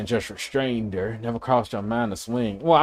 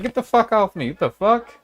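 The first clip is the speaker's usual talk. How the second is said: higher, mock angry get-off-me; retold shove-off yell; 9 semitones higher than usual. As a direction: snap back at her in a high mock yell. Pushing woman away, retold.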